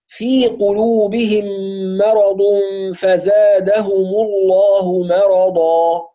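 slow, measured tajwid recitation; Quran recitation. Acted. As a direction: recite slowly with care. (تلاوة مجودة)

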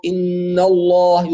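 الله with a thick, emphatic lam, slowly; teacher showing the heavy lam in الله. Shown as a demonstration. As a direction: say الله full and dark after the fatha. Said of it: "تفخم إذا سبقت بفتح أو بضم".

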